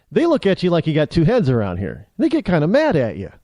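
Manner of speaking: ordinary delivery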